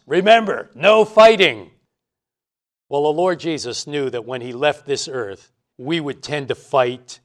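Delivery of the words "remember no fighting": loud, high-pitched parting warning from a parent; insistent parental reminder. Acted. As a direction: hammer the rule home as a parent. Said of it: "parents will say it more than once".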